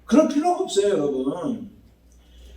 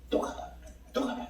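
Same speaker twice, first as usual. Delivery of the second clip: hushed acted order; 7 semitones higher, 17 dB quieter than usual. Acting Elijah sending servant again.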